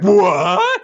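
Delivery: loud, high startled what; mock shock at self-driving cars. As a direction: jolt as if it's unbelievable. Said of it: "you wouldn't be like".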